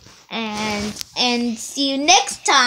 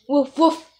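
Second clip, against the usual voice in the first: whispered woof. Acted. Puppy barks in story.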